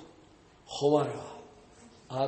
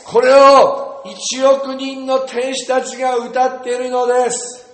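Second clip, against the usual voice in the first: high, very loud, drawn-out proclamation; loud preaching; 7 semitones higher, 11 dB louder, slower than usual. Real, not acted. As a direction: preach it at full force. Climax of shouted group declaration.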